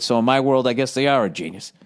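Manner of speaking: normal talking voice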